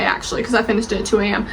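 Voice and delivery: ordinary talk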